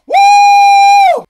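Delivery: long high shouted whoo; piercing, held cheer of excitement; fired-up whoop. Real, not acted. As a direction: let out a loud, high whoop. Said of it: "I'm super fired up".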